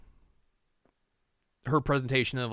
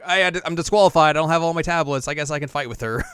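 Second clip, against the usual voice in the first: louder, higher acted outburst that eases off; flustered retort; 6 semitones higher, 9 dB louder, faster than usual. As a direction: blurt it out high, then settle. Acting out a flustered disqualified brother.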